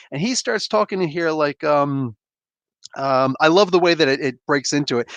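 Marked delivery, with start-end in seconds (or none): none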